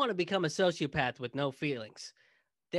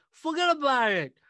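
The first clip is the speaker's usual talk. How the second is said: put-on loud, high New York accent; big, loud attempt at a New York accent; 10 semitones higher, 8 dB louder than usual. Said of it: "best New York City accent I can do".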